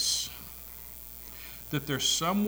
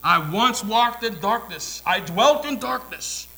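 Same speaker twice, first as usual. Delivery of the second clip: loud testimony; 7 semitones higher, 10 dB louder than usual. Acted. Shepherd proclaiming his past darkness loudly. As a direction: cry it out to the crowd.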